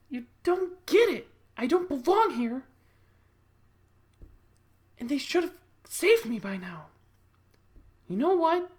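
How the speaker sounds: high, loud, drawn-out pleading; Sarah. Acted. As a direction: plead desperately in a put-on high voice. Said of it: "I'd have Sarah be like".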